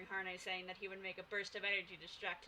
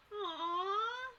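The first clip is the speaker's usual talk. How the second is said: high, louder touched aww; 10 semitones higher, 4 dB louder than usual. Real, not acted. Streamer moved by a viewer's birthday love, high aww.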